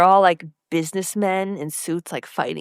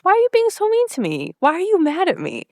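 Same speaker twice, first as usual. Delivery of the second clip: whiny hurt Lorelai; 10 semitones higher than usual. Retold or imitated. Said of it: "And Lorelai says".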